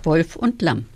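usual speaking voice

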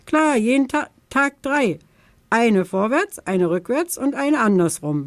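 high, cocky bragging; cheeky boast. Acted. The little raven brags cheekily to Tante Törtchen. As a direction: show off shamelessly. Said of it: "behauptet der Rabe frech".

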